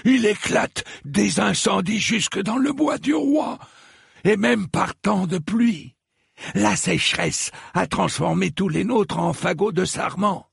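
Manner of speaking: high-pitched, declaiming outcry; stentorian proclamation. A narrator's reading. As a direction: proclaim it across a distance. Septon keeps proclaiming in stentor voice. (proclamation d'une voix forte)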